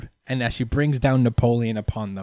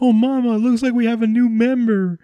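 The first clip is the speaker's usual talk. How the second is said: eager Zeus; 9 semitones higher than usual. Retold or imitated. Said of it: "He's like".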